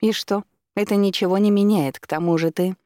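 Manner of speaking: defiant retort. A narrator's reading. Woman brushing off his excuse, defiant. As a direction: argue back, refusing to accept it. Spurned woman arguing back.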